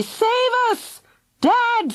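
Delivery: put-on high frantic child scream. A narrator's reading. Fergal screams for his dad as lava arrives. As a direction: shriek for dad to save them. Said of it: "screamed Fergal".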